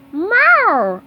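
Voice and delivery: high, loud cartoon meow; snail meow. Gary agreeing with the team, high meow. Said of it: "agreed Gary".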